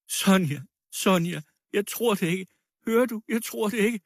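high, fervent exclamations; impassioned cry. A narrator's reading. Woman embracing the accused girl, crying out her trust. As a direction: cry out in protective disbelief. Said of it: "råbte hun".

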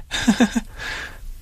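high, soft helpless laughter; high weak giggling; hysterical laugh. A narrator's reading. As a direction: giggle uncontrollably under your breath. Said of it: "Jeg må bare hele tiden le".

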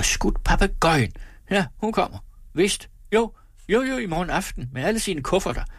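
high, exultant run of assurances; excited announcement. A narrator's reading. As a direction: announce it gleefully, pitched well up. Excited husband announces rich sister's arrival.

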